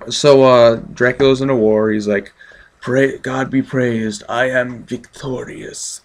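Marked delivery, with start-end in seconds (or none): 2.8-6.0 slow, put-on Eastern European Dracula accent; slow, grand mock vampire-count accent. Reviewer retells Dracula after the war, slow mock Dracula accent. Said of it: "in his Dracula accent".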